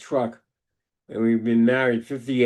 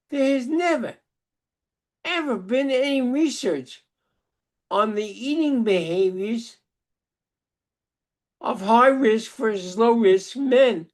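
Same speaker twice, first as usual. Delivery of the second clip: incredulous rebuttal; 8 semitones higher than usual. Retold or imitated. His retold rebuttal.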